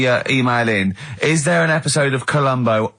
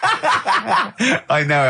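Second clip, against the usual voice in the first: very slow, higher, through laughter; laughing while claiming it; giggly very slow answer; 7 semitones higher, much slower than usual. Real, not acted. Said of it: "laughing as he answers".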